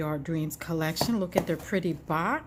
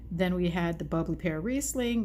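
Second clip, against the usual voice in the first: ordinary talk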